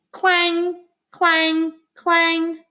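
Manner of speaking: put-on playful ladder clang for children. Shown as a demonstration. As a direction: bang out each clang like a metal ladder. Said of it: "Can you make those sounds?".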